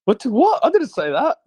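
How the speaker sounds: sudden high, loud "What?" of disbelief; startled surprise. Real, not acted. Host protesting a chat misquote, in surprised disbelief. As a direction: caught off guard, protest it. Shocked at viewer's naked claim.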